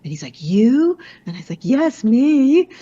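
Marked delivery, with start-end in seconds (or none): 0.4-1.0 high, loud disbelieving you; can't believe it; the teen doubting her rough childhood, surprised; incredulous. Retold or imitated. Teen surprised she had hard childhood. 1.6-2.7 high and loud yes, me; emphatic insistence. Real, not acted. Answering the doubting teen.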